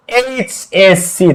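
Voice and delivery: HSC drawn out slowly, letter by letter; slow, separated letters in HSC. Shown as a demonstration. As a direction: spell out HSC slowly, each letter held. Teacher spells out the abbreviation.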